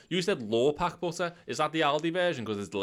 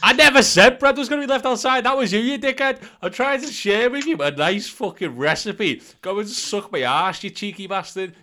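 much higher and louder, an indignant mock tirade; mock outraged rant; 8 semitones higher, 6 dB louder than usual. Ranting at his mate for twisting his recipe, mock furious. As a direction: blow up in hammed-up fury.

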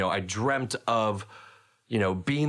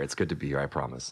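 lower, softer, earnest retake; sincere; 7 semitones lower, 4 dB quieter, faster than usual. Shown as a demonstration. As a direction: say it like you truly mean it.

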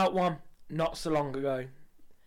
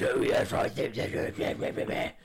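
whispered, drawn-out mock Camilla; breathy lady voice mumbling agreement; much slower than usual. Comedian mimics Camilla at cheese fair, whispered and slow. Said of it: "And she goes".

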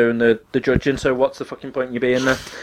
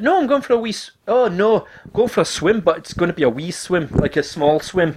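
higher, badly faked Scottish voice; bad Scottish accent; 5 semitones higher than usual. Teller voices housemate clearing up wee swim misunderstanding, bad Scottish accent. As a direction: explain it in a hammed-up Scottish lilt. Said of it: "This is my really bad Scottish accent".

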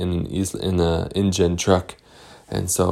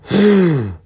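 sharp high gasp of alarm; 11 semitones higher, 6 dB louder than usual. Acted. Storyteller seeing the InGen trucks, a high alarmed gasp. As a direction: gasp at the discovery.

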